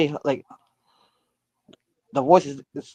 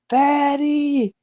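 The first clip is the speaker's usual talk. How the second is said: put-on very high, thin little girl crying Daddy; little girl's voice; 11 semitones higher than usual. Telling friends about the spirit's call, high little girl voice. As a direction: imitate a ghostly little girl calling her father. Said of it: "a little girl's voice goes".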